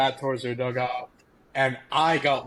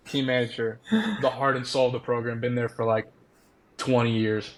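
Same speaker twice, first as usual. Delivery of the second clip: normal talking voice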